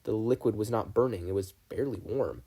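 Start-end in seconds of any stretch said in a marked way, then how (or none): none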